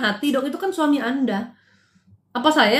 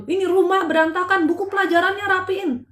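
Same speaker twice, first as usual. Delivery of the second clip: imitating a scolding mother, pitched higher; mock scolding; 6 semitones higher than usual. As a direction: replay the brief scold at her child, raised pitch. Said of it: "kayak orang marah gitu". (ibu memarahi anaknya sebentar, nada omelan tinggi)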